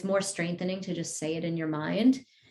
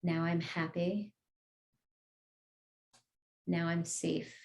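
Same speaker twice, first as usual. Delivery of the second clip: guided meditation; 5 dB quieter than usual. Acted. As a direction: lead the group gently, word by word. Affirmations continue.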